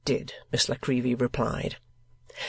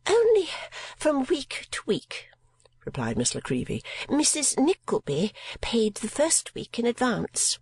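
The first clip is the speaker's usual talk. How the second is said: high, affected spinster voice; mincing lady voice; 10 semitones higher than usual. A narrator's reading. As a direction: play a fussy miniature painter. Miss La Creevy speaks.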